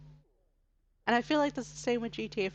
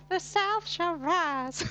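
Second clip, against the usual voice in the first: high, drawn-out Southern drawl; Southern accent; 8 semitones higher, 3 dB louder, much slower than usual. Acted. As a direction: proclaim it slowly in a big Southern accent. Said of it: "Southern accents of Valley Girl".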